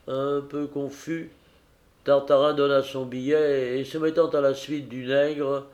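ordinary delivery

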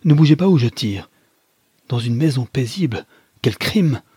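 loud, high threat turning to outrage; acted shouted warning; alarmed exclamation. A narrator's reading. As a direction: order him to freeze, then exclaim. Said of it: "s'écria-t-il".

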